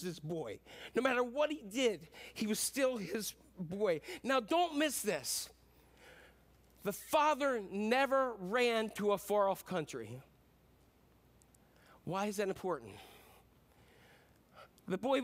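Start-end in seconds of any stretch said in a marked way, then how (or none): none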